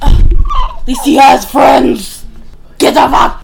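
slow, very loud mock yell; mocking shout. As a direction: bellow it out in scornful mockery. Mocking the character's boyfriend brag.

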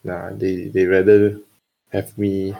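ordinary delivery

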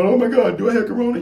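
high, alarmed gasp of a thought; playful fake alarm about corona; mock panic. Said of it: "You're thinking".

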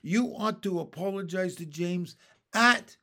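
loud, emphatic telling-off; outraged earthworm's reprimand; indignant scolding. A narrator's reading. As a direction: berate the rude one and demand an apology. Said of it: "cried the earthworm".

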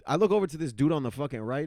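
usual speaking voice

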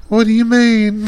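mock Southern belle, high and loud. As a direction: imitate a tipsy Southern belle. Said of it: "she started talking like a Southern belle".

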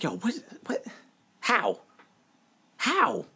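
half-hearted stunned fan in front of the TV. Retold or imitated. Retelling his reaction to an Oregon touchdown, mild mock disbelief.

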